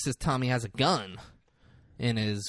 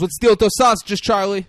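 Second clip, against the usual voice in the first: loud, high-pitched demand; raised voice, pressing for an answer; calling out; 9 semitones higher, 10 dB louder than usual. Retold or imitated. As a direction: call across to the cook, impatient. Acted boss calling to the cook.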